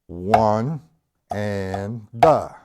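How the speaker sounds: drawn-out "one", then "the" hit hard; accent on THE. Shown as a demonstration. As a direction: count slowly and punch "the". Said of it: "one the is an accent".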